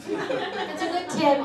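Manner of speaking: drawn-out laughing emphasis on tip; laughing repeat. Shown as a demonstration. Comic repeating her tip to audience, laughing. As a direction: say it again, slow and laughing.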